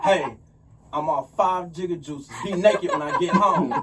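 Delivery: loud, slow hammed-up message with a laugh; texting his shorty. Man texting his shorty after five Jigga Juices, hammed-up and loud. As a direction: draw it out loud and playful, half laughing. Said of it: "your shorty, like, text us".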